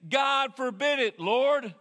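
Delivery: loud, high protest; indignant loud protest; forceful rebuke. Retold or imitated. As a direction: rebuke him like a burly fisherman. Peter rebuking Jesus.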